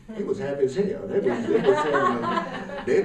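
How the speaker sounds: laughing through the words, breathy giggles; can't stop chuckling while telling it. Real, not acted. To the audience about the rich guests, real laughter.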